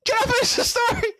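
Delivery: high mocking voice of the girlfriend cut off mid-story; girlfriend mocked, high. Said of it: "She, like".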